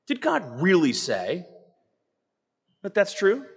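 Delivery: questioning, pitch lifting high at the end; mock doubt. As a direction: cast doubt, pausing midway through the question. Voicing a doubter of Scripture.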